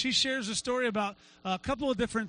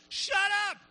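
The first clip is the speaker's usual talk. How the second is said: high, hammed-up command yell; sharp rebuke; 9 semitones higher than usual. Jesus rebuking the waves, comically forceful. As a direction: order the storm silent, high and forceful.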